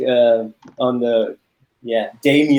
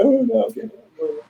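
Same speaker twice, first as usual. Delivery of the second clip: high, small mock-frightened voice fading away; scared giving in; 10 semitones higher, faster than usual. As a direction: cave in like a spooked kid. Retold scared child giving in.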